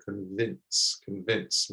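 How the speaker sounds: "convince" said quietly with a short i; quiet, soft vowel in "convince". Shown as a demonstration. Teacher demonstrating "convince" softly to learners. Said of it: "It's softer".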